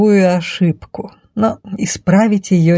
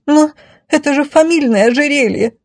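high, indignant lady; affronted, high-pitched objection; 8 semitones higher than usual. Acted. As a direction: protest in a high damsel voice. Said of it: "возмутилась любимая".

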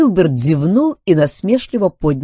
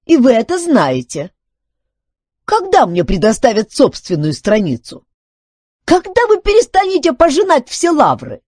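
high, loud, indignant demands; offended complaint; 13 semitones higher, 6 dB louder than usual. A narrator's reading. Filbert demands his own page from Duncan, offended. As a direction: press the grievance, pitched way up. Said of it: "обиженно возразил Филберт".

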